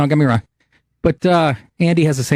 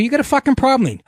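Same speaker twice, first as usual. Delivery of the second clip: higher, heated challenge; angry confrontation; 11 semitones higher than usual. Retold or imitated. Confronting waitress in retold quarrel.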